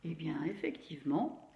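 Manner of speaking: ordinary talk, nothing marked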